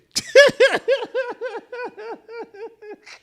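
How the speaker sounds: real high, loud laughing fit; hard laughter. Real, not acted. Comic cracking up at his own story, hard laughter. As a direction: laugh helplessly until it tails off. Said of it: "I can't be making myself laugh that hard".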